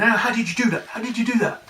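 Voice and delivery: high-pitched, incredulous questioning; mock amazement, pitched right up; confused client. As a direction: imitate a baffled client demanding an explanation. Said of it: "he was confused, going".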